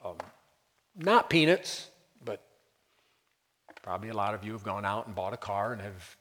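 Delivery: normal talking voice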